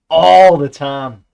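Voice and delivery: slow, loud, emphatic; exasperated shout. Real, not acted. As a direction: bellow it out in frustration. Ranting about Orwell's lists.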